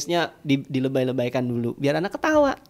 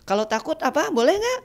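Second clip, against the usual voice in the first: put-on asking children; 7 semitones higher, 4 dB louder than usual. Shown as a demonstration. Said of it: "kita tanya ke anak-anak".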